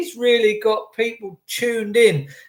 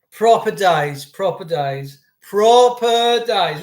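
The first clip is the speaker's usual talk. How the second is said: slow nostalgia; slower than usual. Real, not acted. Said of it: "slow nostalgic emphasis".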